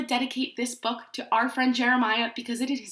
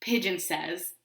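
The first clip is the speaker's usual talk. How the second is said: ordinary delivery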